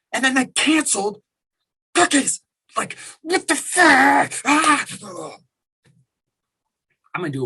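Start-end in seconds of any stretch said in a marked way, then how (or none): 0.1-4.9 high, loud, incredulous outburst; furious at the canceled cookie order; outraged disbelief. Real, not acted. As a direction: squeal it in disbelief. Outraged rant about cookies.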